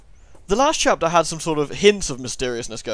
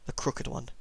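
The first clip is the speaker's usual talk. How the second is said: ominous whisper; 2 semitones lower, 10 dB quieter, slower than usual. Acted. Ominous name in the dark tale.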